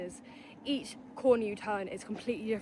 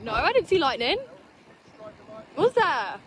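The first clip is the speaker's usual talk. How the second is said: surprised; 4 semitones higher, 8 dB louder than usual. Real, not acted. Surprised at lightning.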